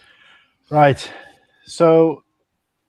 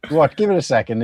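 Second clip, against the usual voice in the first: ordinary delivery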